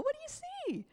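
high playful storytelling voice for grandkids; voice for the kids. Shown as a demonstration. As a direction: talk up high like to little ones.

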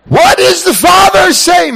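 high, loud excited call; excited shout. Acted. Dad shouting to the kids about the Father.

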